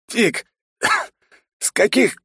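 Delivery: choking, coughing through words; hoarse coughing fit mid-sentence. Acted. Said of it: "закашлялся".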